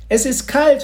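high, loud announcement; bright-voiced girl. A narrator's reading. Girl addressing the whole group, bright high voice. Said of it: "fuhr Mathilda fort".

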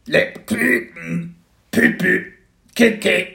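loud high dragon cry, Lebkuchen drawn out slowly; a baby dragon calling out its wishes; slow, high creature call. Acted. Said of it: "rief dann".

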